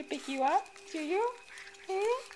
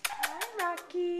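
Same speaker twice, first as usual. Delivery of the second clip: ordinary talk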